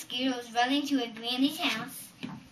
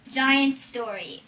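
plain reading voice

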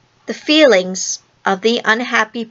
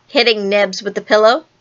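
ordinary delivery

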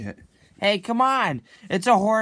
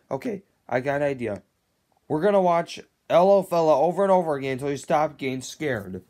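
usual speaking voice

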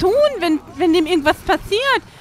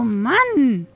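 ordinary delivery

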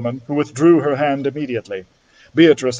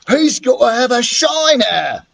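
put-on bully boy; 8 semitones higher than usual. Largest boy threatens.

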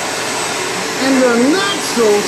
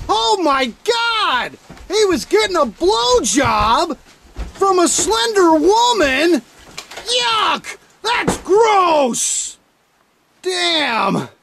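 ordinary talk

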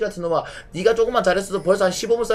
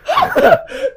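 loud high cackle; high burst of laughter; 6 dB louder than usual. Real, not acted. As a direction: let out a sudden high laugh. Laughs at teammate's play.

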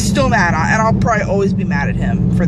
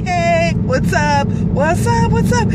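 1 giddy greeting; 7 semitones higher, slower than usual. Pretend. Imagining greeting Johnny Depp.

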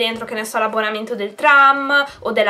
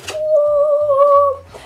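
excited squeal; 14 semitones higher, 4 dB louder than usual. Real, not acted. Opening the surprise box.

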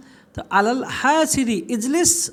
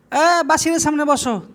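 higher, hailing command; calling out an order; 6 semitones higher, 3 dB louder than usual. Shown as a demonstration. Teacher orders someone to sit before Basir, calling out. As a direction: call over to someone to sit.

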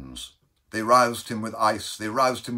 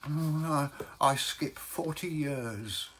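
slow, softer, mildly tearful reply; tearful Baker; 6 semitones higher, 4 dB quieter, slower than usual. Acted. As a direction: falter a little, slowly, as if upset. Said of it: "said the baker in tears".

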